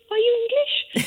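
high, incredulous mock question; imitating puzzled overseas guests asking; visitors asking. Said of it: "And they all go".

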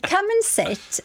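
high-pitched voice of a star inviting someone in. Retold or imitated. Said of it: "but she said".

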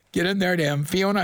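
ordinary delivery

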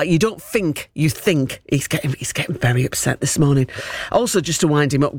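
normal talking voice

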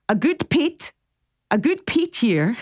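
a lightly faked Shetland accent. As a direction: try out a Shetland lilt, half-joking. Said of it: "I'm trying to do the accent".

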